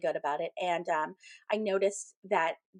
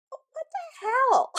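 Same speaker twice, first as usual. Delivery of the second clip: high, slow, drawn-out disbelief; incredulous; 4 dB louder, much slower than usual. Retold or imitated. Medium finds an odd paper on her desk, incredulous. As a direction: re-enact being baffled, stretching it way up high.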